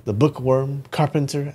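plain reading voice

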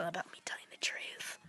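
soft whispered aside; hushed mock honesty. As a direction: whisper the correction.

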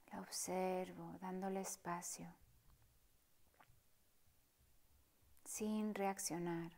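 soft, partly whispered meditation voice; hushed calm guide inviting stillness; guided meditation. Acted. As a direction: guide them gently through the breath, unhurried. Leads a breathing meditation.

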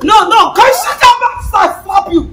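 angry shout. Acted. Madam scolds noisy workers.

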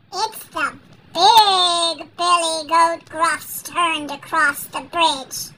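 ordinary delivery, nothing marked

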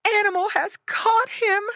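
high, loud wail from mama pig; panicked high pig voice; alarmed cry. A narrator's reading. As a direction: cry out in horror as a mother pig. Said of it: "cried Missus Pig".

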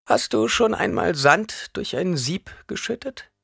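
high, slow crone question; old woman probing, pitched high and unhurried. A narrator's reading. Reverend mother questions the boy about sand, high and slow.

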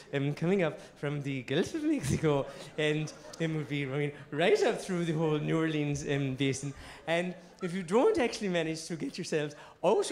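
low, hesitant forecast voice full of ums; halting Irish forecaster, stressing odd words; Irish weather girl. Acted. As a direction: deliver grim news in a lilting, faltering forecast. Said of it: "she was there like".

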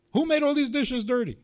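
high, loud mock outrage; indignant dishwasher. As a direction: act out a huffy dishwasher in the kitchen.

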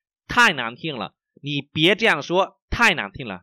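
slow, syllable-by-syllable model reading; slow, carefully enunciated Mandarin for learners. Shown as a demonstration. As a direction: read slowly so each tone is heard. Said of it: "mình đọc rất là chậm".

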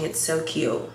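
usual speaking voice

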